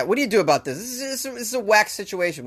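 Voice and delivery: ordinary talk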